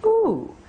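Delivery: ooh with lips rounded, pitched high. Shown as a demonstration. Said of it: "and then rounded lips".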